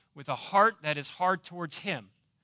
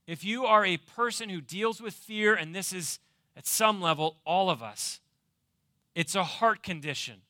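normal talking voice

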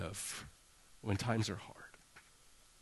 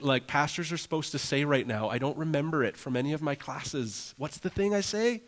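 higher-pitched, pleading mock prayer; desperate prayer; 4 semitones higher than usual. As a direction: beg for help, half-comically helpless. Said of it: "I've prayed prayers like".

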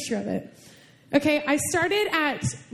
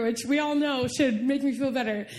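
normal talking voice

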